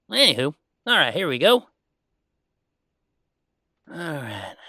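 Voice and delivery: ordinary talk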